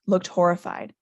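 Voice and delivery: plain reading voice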